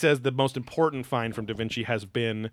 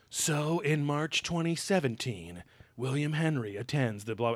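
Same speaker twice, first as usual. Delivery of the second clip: slow, slightly raised narrator read; show narrator; 3 semitones higher, slower than usual. Retold or imitated. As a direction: imitate a documentary voiceover, drawing it out. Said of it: "later in the episode they go".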